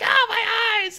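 very high, hammed-up cry; mock agony. Deadpool as his eyes melt, high mock agony. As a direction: yelp in comic pain, high-pitched.

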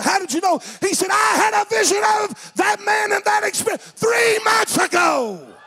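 high, loud revival cry; climactic preaching shout. Retold or imitated. Preacher revealing the friend's vision, full-force high shout. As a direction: shout the revelation as the story's climax.

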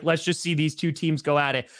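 ordinary talk, nothing marked

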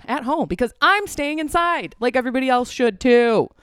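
emphatic rant. Real, not acted. Ranting about staying inside.

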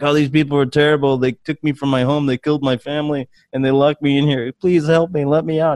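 hammed-up sob story from a prisoner pleading to be let out; fake crying plea. Negan begging visitors to free him, mock tearful. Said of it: "And he's crying and all that".

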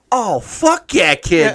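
high, loud laughing Boston rat voice; hammed-up Boston accent, slow and gleeful. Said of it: "they all have a Boston accent".

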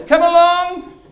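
high, loud call across a foggy hillside; shepherd calling out. Retold or imitated. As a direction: call the flock in from far away, imitating the shepherd. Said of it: "He goes".

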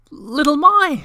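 high, loud delighted cry of a name; joyful shout. A narrator's reading. Moomintroll spotting Little My in the snow, shouting with joy. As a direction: call out her name, overjoyed. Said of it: "shouted Moomintroll".

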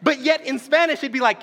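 ordinary talk

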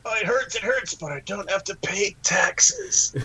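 slow, whispered moaning in pain; mock pained moan. Imagined zombie moaning about pain but glad about taxes. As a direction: whimper it like a hurting zombie, half joking.

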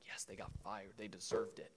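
very soft, low aside; secret gloating. Acted. Gloating privately over others being fired, hushed and low. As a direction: say it under your breath, low. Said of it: "It's like".

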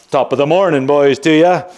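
put-on thick Irish accent. Son mimicking his father's thick fake Irish farewell, lightly done. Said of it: "the thicker that accent got".

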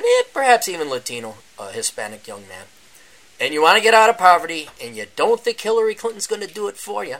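ordinary talk, nothing marked

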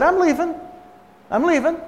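high-pitched, hurried-to-appease reply; imitating someone giving in under attack; placating retreat. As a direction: back off in a high voice. Acted retreat from an angry woman.

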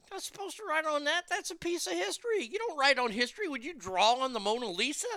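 high-pitched indignant scolding. Real, not acted. Ranting at tourists who write on ancient bricks, high scolding.

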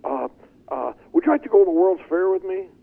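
high, nervous blurted ask; a shy nineteen-year-old asks a girl out on the spot, squeaky and nervous; nervous young suitor. Retold or imitated. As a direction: stammer then blurt it out way up high. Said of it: "I said".

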